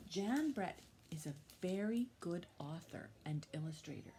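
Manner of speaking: normal talking voice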